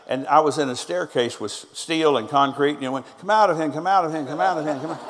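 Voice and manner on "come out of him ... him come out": loud commands that fade a little with each repeat; echoing deliverance shout winding down. Retold or imitated. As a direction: hammer the command again and again, easing off.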